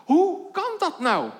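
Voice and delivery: imagined listener wondering aloud; puzzled listener. Pretend. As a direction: voice the congregation's confusion. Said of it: "hoor ik u denken".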